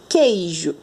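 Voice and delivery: "queijo" with a silent u, just k before ei. Shown as a demonstration. Teacher models the word for pupils, silent U. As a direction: say "queijo" slowly as keh-zhu, no w. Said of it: "Quando eu falo".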